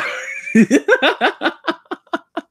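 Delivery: very high, loud rising burst of laughter; shrieking laugh. Real, not acted. Laughs at the joke.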